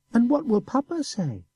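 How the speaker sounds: put-on high boy's voice with a sneer; sneering child. A narrator's reading. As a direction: taunt him innocently. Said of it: "asked Fortunato with a sneer".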